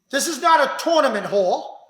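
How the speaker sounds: normal talking voice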